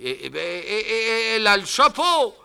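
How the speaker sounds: high loud sputtering; stammering old man. Acted. Deaf uncle stammering that she has the hat. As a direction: sputter then burst out.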